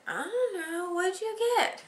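very high, giggly feigned innocence; playing innocent. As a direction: pretend you know nothing, barely holding in laughter. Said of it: "And I said".

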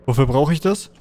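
usual speaking voice

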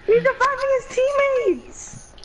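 panicking gamer. Real, not acted. Enemies double teaming.

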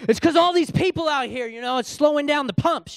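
high-pitched impatient griping; acted exasperated complaint. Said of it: "and I'm just impatient".

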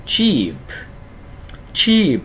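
"cheap" with a long falling ee and a popped p; âm i dài. Shown as a demonstration. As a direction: stretch the ee in "cheap", release the p. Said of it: "âm y dài, chúng ta sẽ đọc là". ("cheap" kéo dài i, bật âm p cuối)

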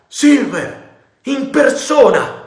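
loud, high astonished shout; cry of surprise. A narrator's reading. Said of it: "con un grido di sorpresa".

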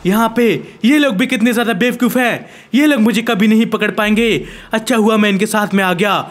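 ordinary delivery

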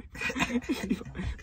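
can't hold the laugh in; high breathy laugh. Real, not acted. Laughing at own idea.

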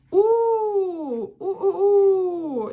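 high, slow, swelling mock howl; wolf howl. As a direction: howl in long "oo" waves like wolves. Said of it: "люблять вити".

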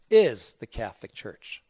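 Is punched loud at the start; stress on Is. Shown as a demonstration. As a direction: hit the word Is hard, then fall. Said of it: "took the word is out".

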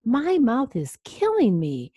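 mild scream. A narrator's reading. Vampire complaining about his sore tooth, mild scream. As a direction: read it as a storybook scream, held in. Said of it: "he screamed".

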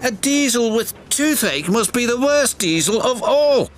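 put-on very high childlike voice; young twin engine. A narrator's reading. Twin predicts the worst diesel of all, emphatic. Ben answers his twin.

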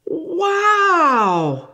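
high drawn-out wow, full of wonder; amazed wow. Acted. Teacher revealing the hidden picture to children, amazed. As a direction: let the wow soar in delight.

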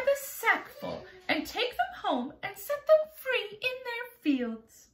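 high, proud little ladybug voice; a prim ladybug boasting sweetly, pitched high. Acted. Ladybug explains to James, high and proud.

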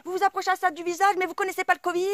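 high, loud, fast alarmed voice; mock panicked woman. Mocking the woman panicking about covid up close. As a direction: shriek like she's scared of germs. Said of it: "elle me dit".

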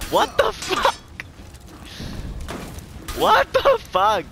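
squealed in shock; high-pitched disbelief. Real, not acted. Disbelief after a parry.

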